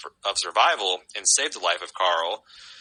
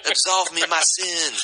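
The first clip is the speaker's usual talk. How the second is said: slow, higher Southern plea; Rick with Southern accent; 8 semitones higher than usual. Retold or imitated. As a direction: beg forgiveness in a Southern accent. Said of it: "It's a pretty good Rick".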